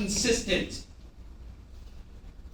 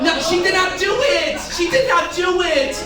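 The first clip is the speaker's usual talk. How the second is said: high, loud scandalised exclamation; theatrical disbelief at full volume; mock outrage; 9 semitones higher, 6 dB louder than usual. Shown as a demonstration. Models an outraged reaction to audience.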